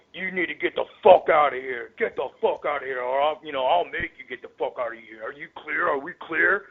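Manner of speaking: aggressive mock threat in a put-on voice; menacing imitation of a tough guy; Corporal Robinson impression. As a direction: play the bully bouncer telling him to leave. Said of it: "even better Corporal Robinson impression".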